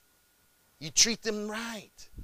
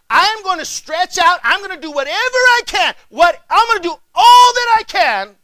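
much higher and louder, building to a full shout; preaching shout; 12 semitones higher, 17 dB louder than usual. Real, not acted. Preaching climax.

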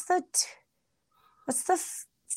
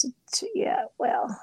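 whispering; 2 semitones higher, 4 dB quieter than usual. Real, not acted. Whispered aside mid-conversation.

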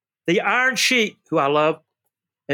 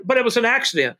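ordinary delivery

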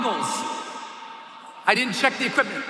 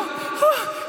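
sharp high gasp of fear; panicked gasp; 12 semitones higher than usual. Retold or imitated. Jumper at the open door on the count, sharp gasp. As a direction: suck in air in terror.

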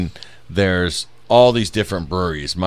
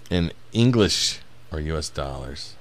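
ordinary talk, nothing marked

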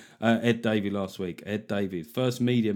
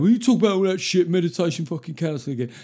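higher, quick scoffing complaint; mock grumbling listener; 5 semitones higher, 4 dB louder, faster than usual. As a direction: parrot the grumpy listeners, fast and dismissive.

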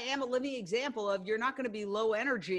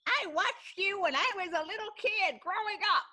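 lightly voiced elderly fan, higher and louder; old fan; 10 semitones higher, 4 dB louder than usual. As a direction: play an old fan gushing, pitched up. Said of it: "and they go".

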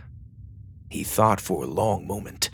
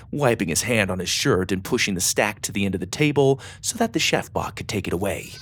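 usual speaking voice

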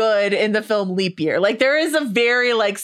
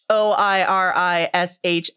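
normal talking voice

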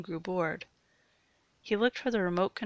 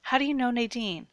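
higher, louder startled demand; raised-voice sudden realization; alarmed question; 6 semitones higher, 8 dB louder than usual. A narrator's reading. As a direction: cut in sharply, taken aback. Alarmed captive questions captor.

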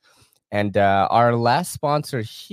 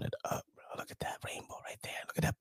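whisper; 15 dB quieter, faster than usual. Real, not acted. Admiring family's campground video.

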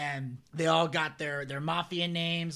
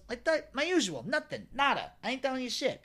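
higher cocky wiseguy voice; 6 semitones higher than usual. Retold or imitated. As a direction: brush off the cop, higher. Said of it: "He goes".

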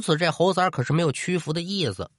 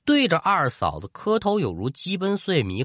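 ordinary delivery

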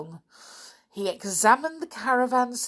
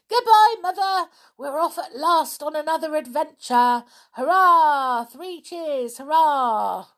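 much higher, much louder cheering farewell; excited shouting; 10 semitones higher, 9 dB louder than usual. A narrator's reading. Anne waving wildly to Mother as they leave, shouting with joy. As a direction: cheer goodbye at the top of your voice.

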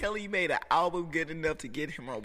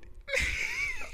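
high shriek of a laugh. Real, not acted. Laughing shout.

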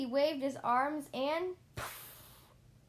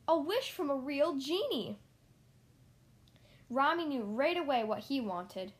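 plain reading voice

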